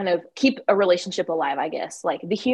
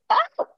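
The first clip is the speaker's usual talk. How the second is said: sharp high gasp before a sneeze; sudden high "ah" as the sneeze builds. Real, not acted. Sneeze about to come.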